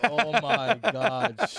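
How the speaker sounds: slow, laughing oh my god; laughing disbelief. Real, not acted. Hearing he pissed on the furniture, laughing in disbelief. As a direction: crack up in disbelief. Reacting to piss story.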